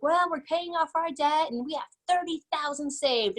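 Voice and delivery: put-on a proud young rookie showing off; proud younger self. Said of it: "And I was so proud".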